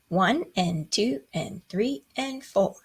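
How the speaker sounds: steady, even counting of the beats; teacher counting one and two aloud, in time. Shown as a demonstration. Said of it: "you count it".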